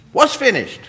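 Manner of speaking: ordinary talk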